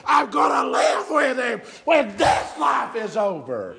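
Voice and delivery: loud, ringing preacher's cry; full-force preaching. Acted. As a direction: preach it out to the whole church. Preaching builds back up.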